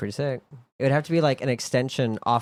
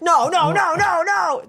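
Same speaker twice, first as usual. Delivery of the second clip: much higher and louder no no no; mock frantic protest; 6 dB louder, slower than usual. Owner hears the dog about to throw up at night, high loud no. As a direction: cry out no in alarm at the dog. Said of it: "and you're like".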